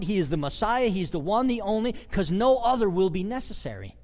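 ordinary talk

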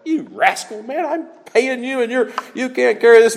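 fed-up boss scolding his hire; lightly hammed angry Balak; upset king. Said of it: "Balak's upset".

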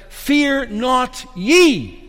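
slow "Fear not ye" with "ye" punched high; stress on YE. Shown as a demonstration. Preacher showing the emphasis in the angel's words, stress on "ye". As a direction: land hard on "ye" after a slow "Fear not". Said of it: "all the emphasis is on that word ye".

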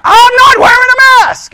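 high, loud defiant yell. Retold or imitated. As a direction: shout the refusal at full volume. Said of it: "he starts raising his voice".

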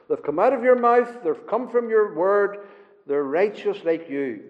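ordinary delivery

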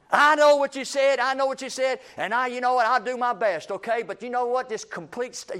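high-pitched, irritated self-justifying protest; defensive outburst. Acted. Preacher acting someone griping back at being corrected, defensive and annoyed. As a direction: cut off the correction with an exasperated protest. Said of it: "that's a worldly way of doing it".

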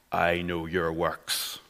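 put-on slow, low, menacing inner city Belfast accent; a faint Belfast caller, slow and low. Anonymous caller threatening the listener in a slow Belfast accent. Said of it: "this strong inner city Belfast accent".

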